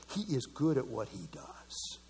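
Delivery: low conspiratorial whisper; hushed, grudging admiration; whispered aside. Acted. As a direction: whisper it like a secret about the devil. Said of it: "I gotta give the devil some credit".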